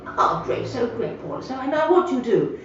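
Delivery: hammed-up posh old man greeting a newcomer; posh gentleman. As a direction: lay on the posh welcome like a retired squire. Posh gentleman asks her job.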